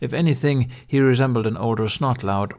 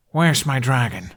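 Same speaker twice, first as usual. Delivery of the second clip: louder, pushed question; hissed demand; 3 semitones higher, 4 dB louder than usual. Acted. Hiccup hissing at the Viking about his dragon. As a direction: hiss it at the Viking. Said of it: "Hiccup hissed".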